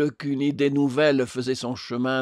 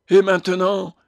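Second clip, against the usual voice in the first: theatrical despair; 7 semitones higher, 7 dB louder than usual. A narrator's reading. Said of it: "avec un grand geste de désespoir".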